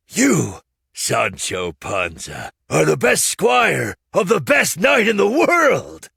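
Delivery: loud, high, sweeping praise; loud flattery. A narrator's reading. Puppeteer praising Sancho to his face, loud and high. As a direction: heap praise on him loudly.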